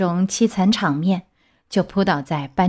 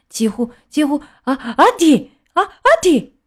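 building into two loud, high sneezes; acted sneezes; 7 semitones higher, 4 dB louder than usual. A narrator's reading. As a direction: hold it back, then burst out sneezing. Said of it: "又打了两个喷嚏". (憋不住连打两个喷嚏)

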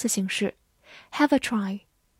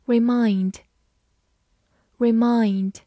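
slow, drawn-out word; slow review word; 3 dB louder, much slower than usual. Shown as a demonstration. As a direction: stretch each syllable slowly. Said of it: "复习一下今天学过的单词".

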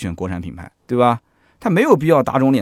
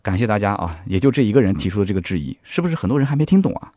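normal talking voice

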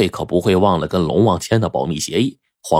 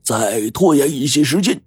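put-on much higher, earnest old man; pitched-up elder giving last orders; old master's instructions; 9 semitones higher than usual. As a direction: warn them solemnly as a Taoist elder. Old Deng gives parting instructions.

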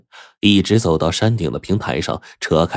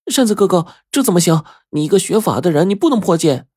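put-on much higher, urgent startled girl's voice; 12 semitones higher, 4 dB louder, faster than usual. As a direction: protest quickly, shocked. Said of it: "小梅吓了一跳，连忙说".

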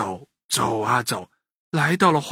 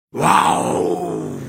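low, drawn-out beast roar; tiger's roar; 5 semitones lower than usual. A narrator's reading. Tiger wakes the sleeping boy, roaring. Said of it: "一声巨大的吼声".

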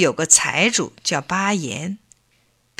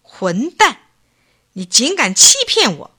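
loud, higher furious accusation; fuming; 5 semitones higher, 5 dB louder than usual. Acted. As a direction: spit out the insult in a huff. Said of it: "气呼呼地说".

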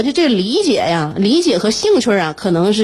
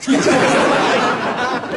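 laughing; 4 semitones higher than usual. Real, not acted. Laughs at own story.

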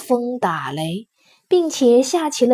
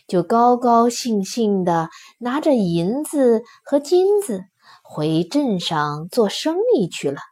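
plain reading voice

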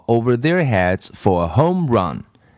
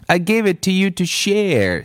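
mildly indignant, higher-pitched retort; arguing back; 8 semitones higher than usual. A narrator's reading. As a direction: argue back with pitch raised. Acted quarrel over the ball.

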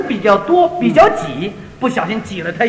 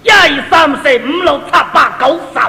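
Cantonese counting; 3 semitones higher than usual. Shown as a demonstration. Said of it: "一不叫一".

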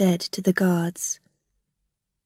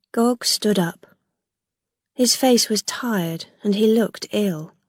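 ordinary delivery, nothing marked

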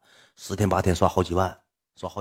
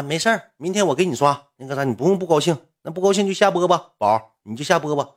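imitated sweet higher-pitched reassurance; kind female fan; 8 semitones higher than usual. Woman fan consoling the streamer, imitated high and reassuring. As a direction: coax in a high, put-on woman's voice. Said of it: "那个女的挺好啊".

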